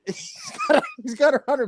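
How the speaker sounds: high laugh breaking into the words. Real, not acted. Recalling the porn shoot, laughing.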